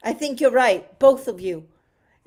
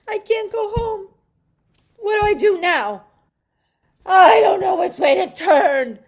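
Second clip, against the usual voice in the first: higher, rising to a loud cry; distraught; 7 semitones higher, 6 dB louder than usual. Acted. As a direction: panic building to a shout. Despair after insurance denial.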